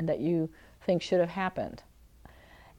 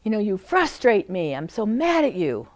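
frustrated; 3 semitones higher, 6 dB louder than usual. Shown as a demonstration. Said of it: "here you are like".

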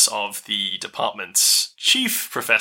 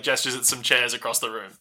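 ordinary talk

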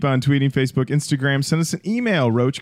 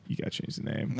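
whispered court ruling; 12 dB quieter than usual. Retold or imitated. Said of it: "the British court was like".

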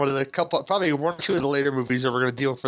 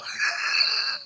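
airy, voiceless laugh. Real, not acted. Host hears of drunken Shatner, breathy laugh. Laughs at co-host's drinking remark.

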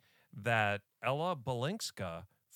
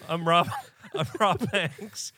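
laughing; 6 semitones higher, 9 dB louder than usual. Pretend. Playful introduction as Rob Hanks.

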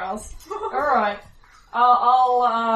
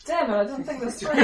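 ordinary talk, nothing marked

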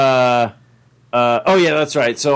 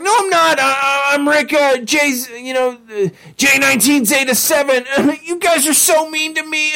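very high, stammering, laughing nervously; hurt Doofus Rick; 13 semitones higher than usual. Retold or imitated. Doofus Rick protesting to the other Ricks, very high and hurt. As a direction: whine that they're mean. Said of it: "He's like".

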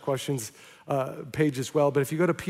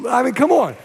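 higher, louder, amazed; come on; 6 semitones higher, 6 dB louder than usual. Real, not acted. Preacher marvels to the church, excited. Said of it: "preacher's aside".

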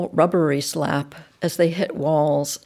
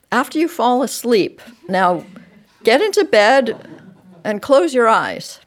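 raised, insistent parent voice; louder, higher firm instructions; firm parental order; 6 semitones higher, 5 dB louder than usual. A narrator's reading. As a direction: lay down the bedtime law. Parent orders child to bed.

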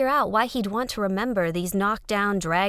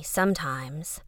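usual speaking voice

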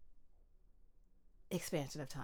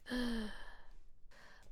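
breathless gasp; 5 semitones higher, 6 dB quieter than usual. Retold or imitated. Recalling breathlessness of hurdling.

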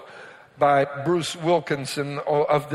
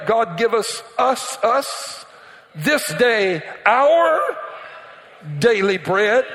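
fervent prayer; 4 semitones higher, slower than usual. Real, not acted. Quoting the Lord's prayer fervently.

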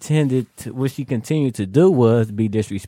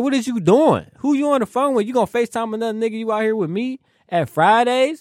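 retold cuss-out, pitched much higher; half-acted high-pitched telling-off at his date; cussing her out; 10 semitones higher than usual. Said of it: "I cussed her out".

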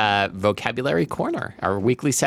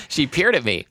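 normal talking voice

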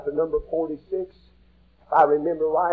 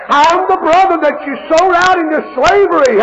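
tearful cry; 11 semitones higher, 8 dB louder than usual. Acted. Joseph revealing himself, weeping.

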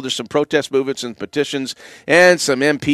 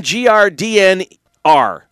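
normal talking voice

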